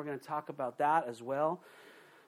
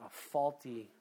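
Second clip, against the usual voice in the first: usual speaking voice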